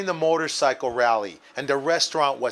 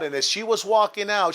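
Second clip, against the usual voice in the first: ordinary talk, nothing marked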